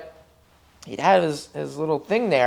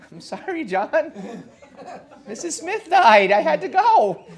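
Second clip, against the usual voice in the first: much higher, laughing through the excuse; mock-sheepish excuse to John, laughing; laughing apology; 11 semitones higher, 4 dB louder than usual. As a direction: giggle out a sheepish sorry.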